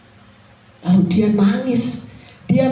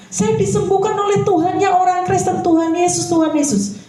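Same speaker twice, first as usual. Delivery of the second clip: high, elated exclaiming; mother telling her children who healed her; joyful testimony; 9 semitones higher than usual. Acted. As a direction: proclaim it with delight, pitched up.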